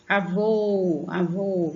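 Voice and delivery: Avô twice, stress held on VÔ; puxar o vô. Shown as a demonstration. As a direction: stretch the VÔ in Avô. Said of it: "é o vô que você puxa". (Avô com o VÔ puxado)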